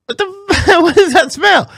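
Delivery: high, acted outburst of disgust; appalled disbelief. Lifter reacting to a gym stench, appalled disbelief. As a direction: voice jumps way up, appalled.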